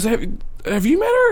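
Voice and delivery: high-pitched questioning voice; teacher character. Acted. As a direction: ask it up high, probing. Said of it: "looks to Kayla and goes".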